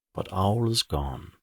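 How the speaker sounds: usual speaking voice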